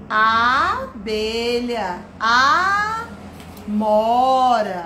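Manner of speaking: "Abelha, amora" very slow, long first A; extended vowel. Shown as a demonstration. Teacher listing words starting alike, "Abelha, amora" with long A. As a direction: hold the opening A of "Abelha" and "amora". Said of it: "coisas que iniciam com".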